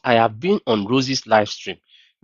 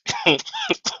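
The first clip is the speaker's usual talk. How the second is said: short high burst of laughter; 3 dB quieter than usual. Real, not acted. Laughing at the scammer's job claim, high laugh.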